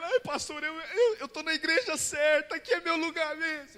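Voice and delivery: high, tearful mock sobbing voice; imitating a big man breaking down; crying man. The convert weeps to his pastor, high and tearful. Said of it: "aquele homão começou a chorar".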